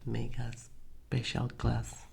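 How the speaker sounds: ordinary talk, nothing marked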